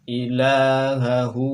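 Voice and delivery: "Ilahahu" slow and loud, long la; model Arabic reading. Shown as a demonstration. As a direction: stretch out "Ilahahu". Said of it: "samakan dengan contoh pelafalan kami". ("Ilahahu" pelan, mad dipanjangkan)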